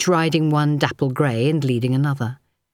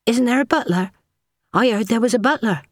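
put-on higher, curious young maid; maid's voice; 8 semitones higher than usual. A narrator's reading. Maid asks the first footman about the butler, higher and curious. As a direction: ask it innocently, lifting the voice.

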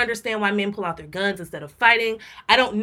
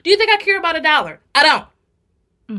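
higher, louder and fast; fast indignant rant; 7 semitones higher, 6 dB louder than usual. Real, not acted. Scolding the cashier about a dollar, fast and loud. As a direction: fire it off, indignant.

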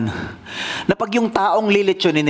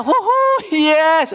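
joyful whoop; 11 semitones higher, much slower than usual. A narrator's reading. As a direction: whoop it out like you just escaped. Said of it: "ang saya-saya niya". (masayang hiyaw ng tuwa)

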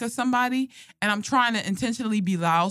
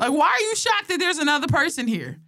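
higher, indignant mock questioning; mad; 6 semitones higher than usual. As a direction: retell the outrage, half laughing. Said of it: "And I got so mad".

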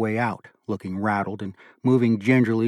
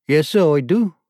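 put-on a light Australian accent, raised and louder; lieutenant answers the board in Australian accent; 6 semitones higher, 6 dB louder than usual. A narrator's reading. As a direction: answer crisply with Australian vowels. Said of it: "Jiff's accent had a startling effect".

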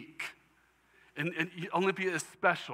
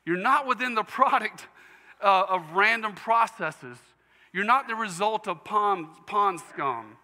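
much higher and louder, preaching at full force; fervent preaching; 6 semitones higher, 4 dB louder than usual. Real, not acted. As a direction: pour it out on the congregation. Preacher building to fervent climax.

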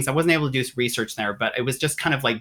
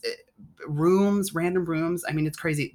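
usual speaking voice

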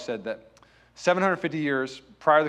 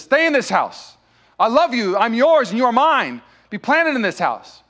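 raised, higher voice, pleading with conviction; impassioned declaration; 6 semitones higher, 6 dB louder than usual. Acted. Hosea takes Gomer back home, impassioned declaration. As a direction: declare it firmly and ardently.

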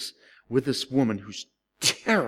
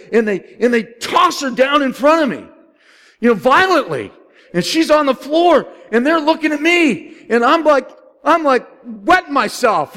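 loud, high, gripping retelling of the scare; building drama, voice way up and loud; excited storytelling; 12 semitones higher, 9 dB louder than usual. Real, not acted. Pastor reliving the shock before the congregation, loud and excited. Preacher acts out dramatic church scene.

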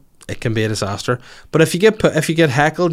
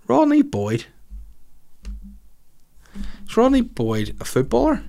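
puzzled high query; 11 semitones higher than usual. Real, not acted. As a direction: pitch leaps much higher, baffled. Puzzled over a listener's name.

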